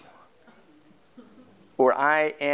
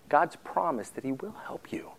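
unvoiced whispered promise; 5 dB quieter than usual. Real, not acted. Pastor whispering God's promise to the congregation. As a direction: hush it like a secret. Hushed aside in sermon.

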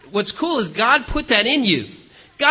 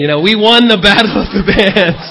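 loud, laughing triumph; laughing with pride; 7 dB louder than usual. Real, not acted. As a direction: laugh through the win. Proudly recalls winning, laughing.

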